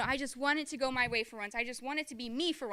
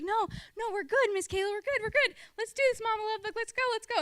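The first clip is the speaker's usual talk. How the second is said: much higher, excited pleading; eager kids; 9 semitones higher than usual. Retold or imitated. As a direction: bounce it like kids eager to make up.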